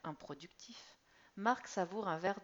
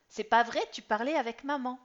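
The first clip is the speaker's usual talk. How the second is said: put-on loud, high accusing boy; indignant teen; 6 semitones higher, 14 dB louder than usual. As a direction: catch dad out, loudly. Son accuses father.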